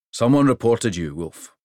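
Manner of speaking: a big king pleased with the trip; hearty king. Acted. King's voice.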